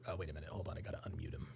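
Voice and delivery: very low, hushed talk to oneself; muttered aside. Real, not acted. Host fumbling to unmute a caller, muttered aside. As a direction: drop right down to a near-whisper.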